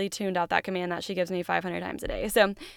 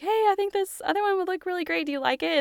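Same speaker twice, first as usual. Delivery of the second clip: much higher, light and coaxing; sweet coaxing to daughter; 10 semitones higher than usual. Retold or imitated. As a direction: suggest gently to a little daughter. Gently asking daughter about bow.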